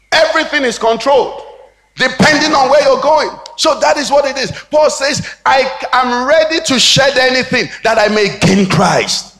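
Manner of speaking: high, driving pulpit delivery; forceful preaching. Real, not acted. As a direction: preach it hard, building to an emphatic cry.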